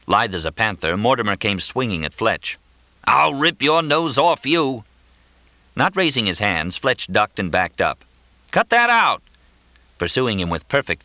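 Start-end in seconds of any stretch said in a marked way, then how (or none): 3.1-4.8 high, drawn-out threat; angry threat. A narrator's reading. Swinging at him in a fight. 8.5-9.2 very high, loud protest; alarmed shout. A narrator's reading. Ducking blows in a fight.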